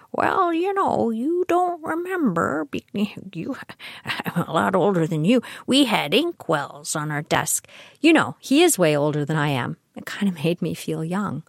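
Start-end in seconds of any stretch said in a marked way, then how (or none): 0.1-7.7 put-on old man voice. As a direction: quote him like a gentle old man. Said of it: "softened old man voice".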